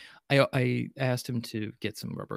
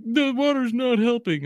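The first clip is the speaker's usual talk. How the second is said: put-on high, loud pained kid voice; a child in agony over the spice, much higher and louder; whiny kid; 11 semitones higher, 7 dB louder than usual. Kid with mouth burning.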